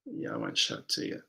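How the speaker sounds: breathy teasing whisper. Real, not acted. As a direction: whisper it playfully. Teasing refusal, unvoiced.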